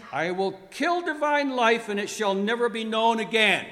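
Death bellowing a threat, high and very loud; full-force theatrical bellow. A narrator's reading. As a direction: roar it out as Death personified. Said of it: "Bellowed death".

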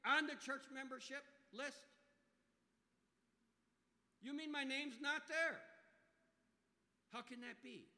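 high, loud, disbelieving self-righteous member; indignant churchgoer. Retold or imitated. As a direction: build outrage that his name is missing. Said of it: "it's sort of like".

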